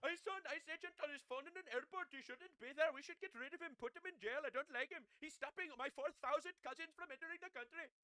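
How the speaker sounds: high put-on voice mocking a Democrat; mock liberal voice.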